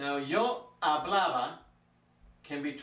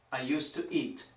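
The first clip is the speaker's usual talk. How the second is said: ordinary talk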